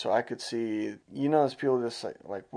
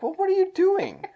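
incredulous; 4 dB louder than usual. Retold or imitated. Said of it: "I'm like".

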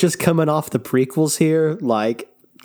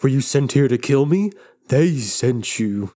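put-on paranoid old rebel. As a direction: drag it out, deeply suspicious and paranoid. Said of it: "he's like".

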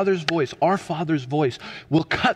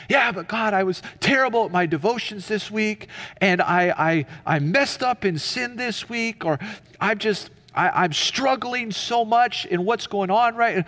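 higher-pitched, stumbling excuses; protesting believer; 5 semitones higher than usual. Acted. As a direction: protest to God, restarting as you confess. Voices a struggling believer protesting.

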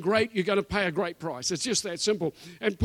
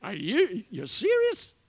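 incredulous; 4 semitones higher than usual. Retold or imitated. Retelling his reaction to the uncle's hand plough, incredulous high squeak. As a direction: voice jumping far higher in disbelief. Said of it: "I kinda go".

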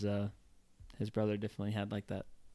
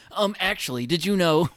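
loud, high-pitched know-it-all interjection; mock "um, actually" corrector, pitched up; 8 semitones higher, 11 dB louder than usual. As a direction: butt in like an insufferable pedant. Mimics people who correct others.

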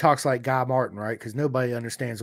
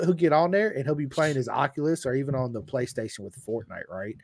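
ordinary delivery

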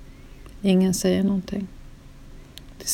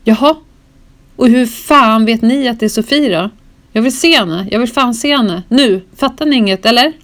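much louder, higher furious demands; louder hostile shouting; nasty angry outburst; 4 semitones higher, 8 dB louder than usual. Retold or imitated. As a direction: lash out at the officers. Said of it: "bli elak mot dem".

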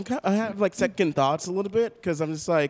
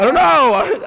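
flustered; 7 semitones higher, 7 dB louder, slower than usual. Acted. Flustered character answer.

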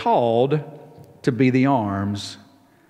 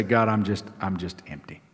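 hollow empty prayer; 6 semitones lower, 7 dB quieter than usual. Retold or imitated. A worshipper telling God they are empty, softly, barely voiced. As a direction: drop to a soft, spent whisper of a prayer. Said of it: "come before the Lord and say".

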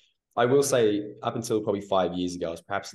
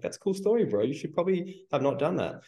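high, sarcastic "cool story bro" voice; mock the client with a high sarcastic sneer; 10 semitones higher than usual. Sarcastic coach reply.

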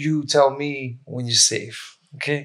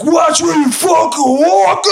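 loud, much higher angry shout, slowly; angry drunk yell; 5 dB louder, slower than usual. Retold or imitated. Punched drunk guy yelling at the stranger, angry shout. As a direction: bark at him like a drunk at a concert. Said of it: "the guy's like".

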